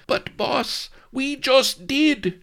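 timid, high-pitched, slow faltering reply. Acted. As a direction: stammer it out to an angry boss. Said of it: "faltered the chamberlain".

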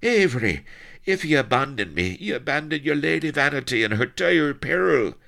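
high, loud desperate warning; bawling. A narrator's reading. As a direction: bawl a warning to stop them leaving. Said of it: "bawled Blood".

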